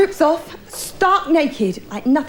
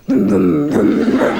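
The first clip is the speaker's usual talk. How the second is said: mock engine noises; 6 semitones lower, slower than usual. Wife's engine noises at night.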